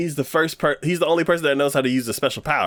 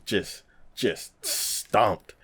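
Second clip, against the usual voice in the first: usual speaking voice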